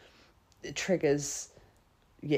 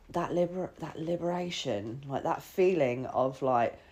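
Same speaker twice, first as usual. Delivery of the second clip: usual speaking voice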